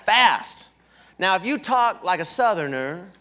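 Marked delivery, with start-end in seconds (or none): none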